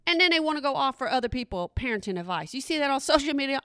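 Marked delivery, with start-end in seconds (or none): none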